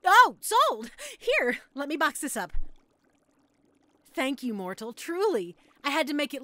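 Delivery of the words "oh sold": loud, high burst of delight; thrilled exclamation. Acted. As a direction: cry out at clinching the sale. Thrilled at making the sale.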